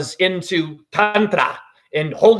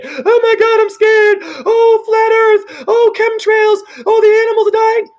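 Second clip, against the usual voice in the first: shrill, much higher and louder mock fright; mock panicky believer; 5 dB louder than usual. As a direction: ham up a terrified conspiracy believer, wailing. Mocking fearful doom-believers.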